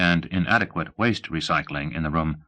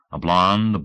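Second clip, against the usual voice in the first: usual speaking voice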